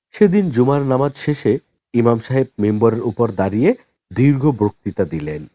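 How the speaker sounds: ordinary delivery, nothing marked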